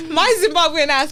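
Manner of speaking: laughing high and loud; giddy laugh through the words. Real, not acted. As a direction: burst out laughing mid-phrase. Laughing at her own story.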